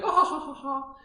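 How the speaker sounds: high, loud put-on child laughter; imitated child's laugh. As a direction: mimic a tickled child laughing. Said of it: "then the child's like".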